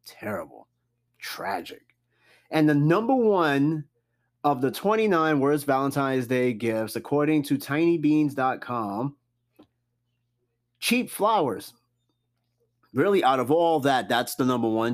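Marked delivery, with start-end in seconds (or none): 0.1-2.0 soft, low whispered tragic; whispered verdict. Real, not acted. Host mourning an expired coupon gift, whispered. 10.8-11.7 cheap flowers lifted high in disbelief; high reveal. Real, not acted. Host revealing the top worst gift, high and surprised.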